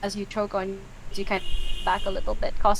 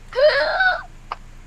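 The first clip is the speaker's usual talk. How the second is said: high, loud shocked gasp; whole table gasping in shock; collective gasp; 4 dB louder than usual. Acted.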